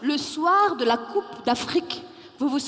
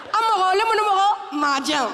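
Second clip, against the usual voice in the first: Malian exclamation; 6 semitones higher, 3 dB louder than usual. Acted. Malian phrase shouted.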